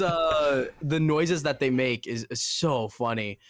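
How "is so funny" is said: normal talking voice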